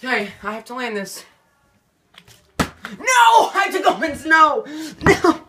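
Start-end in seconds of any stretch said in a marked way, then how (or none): 3.0-5.4 desperate shout. Real, not acted. Loses the flip, must go barefoot.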